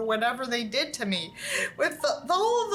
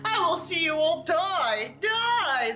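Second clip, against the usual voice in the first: higher, slow, raging threat; furious scream; 6 semitones higher, slower than usual. A narrator's reading. Hero threatening the aliens with a raging scream. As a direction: spit the threat out in fury. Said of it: "I screamed again".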